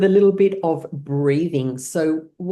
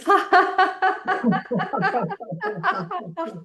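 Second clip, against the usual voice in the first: long high peal after held breath; released laughter. Shown as a demonstration. Teacher releases held breath with class, long high laugh. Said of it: "breathe it out with laughter".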